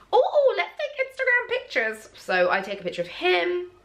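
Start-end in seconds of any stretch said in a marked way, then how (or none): 0.1-2.1 very high excited squeal; giddy eagerness. Retold or imitated. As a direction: pipe up with a sudden bright idea. Retells own eager idea for photos.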